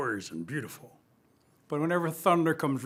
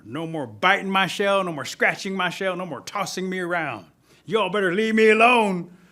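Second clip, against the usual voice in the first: raised voice building to a high shout; defiant warning; 4 semitones higher, 8 dB louder than usual. Acted. Turtle telling bullies to leave him alone, loudly defiant. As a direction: list your grievances, then yell the warning.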